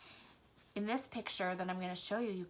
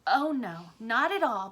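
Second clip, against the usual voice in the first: loud emphatic denial; reassuring; 3 semitones higher, 9 dB louder than usual. A narrator's reading. Blinker reassuring Ragweed, loud and emphatic. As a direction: insist it firmly, louder. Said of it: "Blinker reassured".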